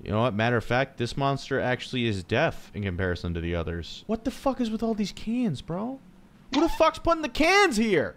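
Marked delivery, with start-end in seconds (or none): 4.1-8.1 high, incredulous squeal at the cans; exasperated outburst. Real, not acted. Player shrieking at cans in a dark hallway, incredulous. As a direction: shriek in disbelief at the clutter. Annoyed at cans in horror game.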